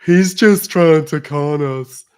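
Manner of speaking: slow, loud, whiny mockery of critics; mocking whiny voice. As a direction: sneer it like an offended complainer. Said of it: "sensitive butthurt types who get".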